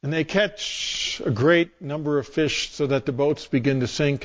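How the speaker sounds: ordinary talk, nothing marked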